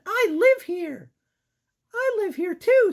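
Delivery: put-on squeaky girl mouse; high-pitched cartoon mouse. Voicing mouse characters.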